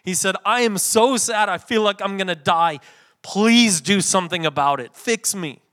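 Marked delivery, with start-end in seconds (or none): 0.4-2.8 loud, high, overwrought declaration of sadness; mock desperation. What Jesus didn't say to friends, mock desperation. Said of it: "He didn't say that". 3.2-5.6 loud, demanding appeal to be rescued; mock desperate plea. Imagined plea to friends to fix him, mock desperation. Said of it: "Did he say that?".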